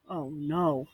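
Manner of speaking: ordinary talk, nothing marked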